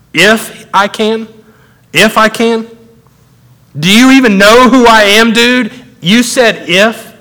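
high-pitched, incredulous comeback; sarcastic disbelief. Acted. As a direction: echo it slowly, then build to indignant challenge. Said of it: "but little cynicism".

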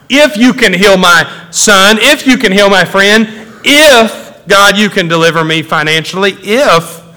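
high, emphatic, incredulous list; mock doubtful prayer. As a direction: hammer each IF in mocking disbelief. Mocking doubtful prayers to God.

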